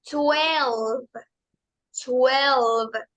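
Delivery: Twelve with W as U and final V, silent E; English twelve. Shown as a demonstration. Teacher modelling for students, careful Twelve. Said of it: "Se pronuncian las dos".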